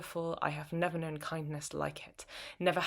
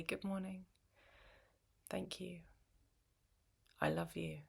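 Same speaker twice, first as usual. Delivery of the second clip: soft morning murmur; 2 semitones higher, 8 dB quieter than usual. Acted. Taking coffee from his hand.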